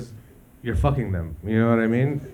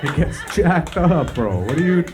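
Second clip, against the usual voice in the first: slow, higher and louder bro drawl; hyped toilet; 5 semitones higher, 4 dB louder, slower than usual. Pretend. Toilet still partying over the vomit, slow hyped bro. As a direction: stretch it out, pumped up.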